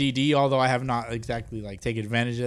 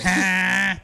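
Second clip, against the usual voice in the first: rapper ad-lib; 6 semitones higher, 6 dB louder than usual. Retold or imitated. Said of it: "Who goes".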